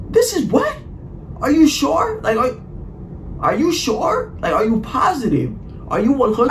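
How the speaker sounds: high, squeaky sarcastic questioning; exaggerated high mock-incredulous voice; mocking disbelief. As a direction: needle her, squeak each "are you sure". Mocking her claim in his head.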